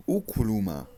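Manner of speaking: normal talking voice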